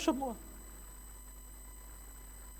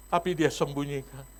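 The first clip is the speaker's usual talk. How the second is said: ordinary delivery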